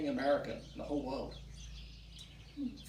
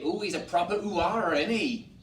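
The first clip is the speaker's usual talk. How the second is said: louder, higher West Country farmer lilt; rural English farmer accent; 5 semitones higher, 6 dB louder than usual. Shown as a demonstration. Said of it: "so in England we say".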